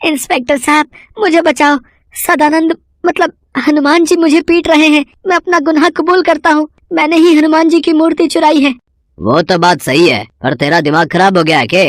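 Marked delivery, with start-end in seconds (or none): 0.0-8.7 very high, breathless frightened pleading; high frantic voice begging for help; panicked confession. Acted. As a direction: blurt it all out to the inspector in terror. Runs to police, still panicked.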